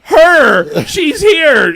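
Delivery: loud retold protest, pitch climbing; indignant outcry. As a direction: cry out in mock outrage at being overlooked. Retelling panel guests' outraged objection.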